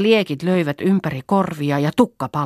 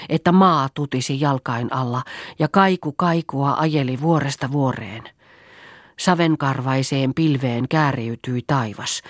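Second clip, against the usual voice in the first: ordinary delivery, nothing marked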